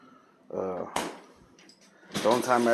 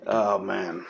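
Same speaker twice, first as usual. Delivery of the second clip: ordinary delivery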